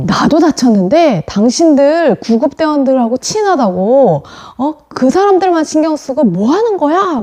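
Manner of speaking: 높은 음으로 따지듯 재연한 항의; 억울해서 쏘아붙이는 환자를 흉내 내기. Retold or imitated. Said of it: "그 사람이 막 화를 내는 거예요". (화내며 따지는 사람 흉내)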